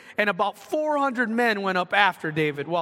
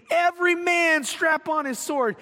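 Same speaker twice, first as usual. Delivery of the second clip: angry command; 8 semitones higher than usual. Retold or imitated. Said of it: "I guarantee that was not said".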